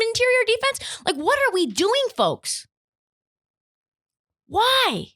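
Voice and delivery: pitched high, pleading with disbelief; climbing, incredulous why-questions; exasperated rant. Real, not acted. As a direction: throw up your hands in disbelief. Ranting at Boston fans, exasperated.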